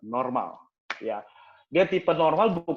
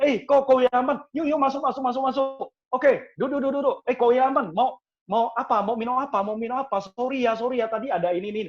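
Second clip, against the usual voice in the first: high-pitched, gushing welcome; eager go-getter host; 9 semitones higher, 4 dB louder than usual. Acted. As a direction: usher a guest in with eager, repeated words. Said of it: "Saya sebagai orang yang penggerak, saya biasanya akan panggil".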